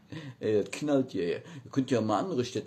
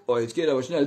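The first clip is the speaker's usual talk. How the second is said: ordinary talk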